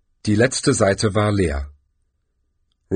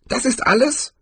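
put-on high-pitched old warrior's question; much higher character voice, rising at the end; old barbarian. A narrator's reading. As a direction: ask it high, as an aged barbarian. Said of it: "den greisen Barbar".

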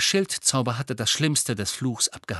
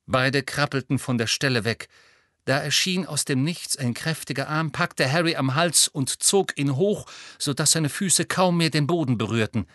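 plain reading voice

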